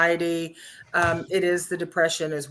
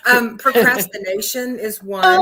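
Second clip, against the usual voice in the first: laughing; 7 semitones higher, 7 dB louder than usual. Real, not acted. Still laughing from line 12.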